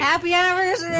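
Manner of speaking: high-pitched cheer of congratulations; celebratory cheer. Real, not acted. Co-host cheers the show's anniversary.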